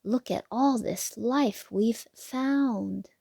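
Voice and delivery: ordinary delivery, nothing marked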